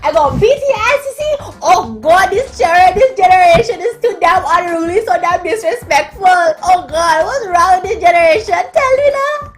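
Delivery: very high, loud outraged mother; angry mama. Retold or imitated. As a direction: rage like a protective mom ready to fight. Mom voiced in story.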